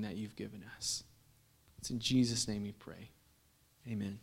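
soft, hushed prayer voice. Real, not acted. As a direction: quietly pray over the congregation. Pastor prays before sermon.

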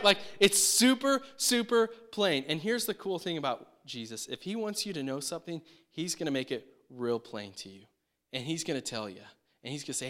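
usual speaking voice

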